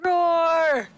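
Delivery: playful monster roar. Pretend. Player roaring for the newly summoned demon, playful monster roar.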